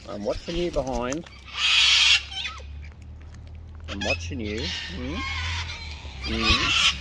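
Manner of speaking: soft, hushed coaxing. Real, not acted. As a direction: murmur so as not to startle the birds. Hushed talk near birds.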